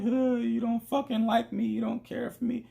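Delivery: very high, wailing imitation of a crying girlfriend; mock crying. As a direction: wail it like a tearful, wounded girlfriend. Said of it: "She crying on the ground".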